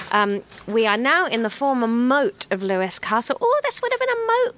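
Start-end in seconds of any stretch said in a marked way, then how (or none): 3.4-4.6 high silly voice. Pretend. High excited put-on voice.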